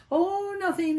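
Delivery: high, put-on breezy denial; feigned nothing's wrong. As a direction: brush it off in a falsely light voice. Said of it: "I knew they were upset".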